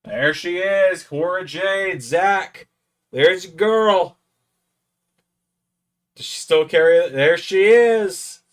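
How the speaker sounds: loud, drawn-out cheering; excited hype shout. Real, not acted. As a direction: hype her up like a fan at ringside. Cheering a wrestler appearing on screen.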